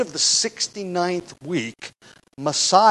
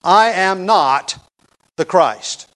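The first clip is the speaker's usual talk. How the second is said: slow, loud, heavily weighted denial; emphatic statement; 3 semitones higher, 7 dB louder, much slower than usual. Retold or imitated. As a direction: drive home each word, pausing before "the Christ". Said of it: "He stated emphatically".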